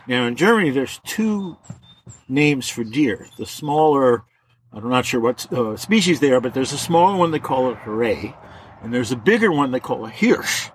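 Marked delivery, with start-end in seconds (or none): none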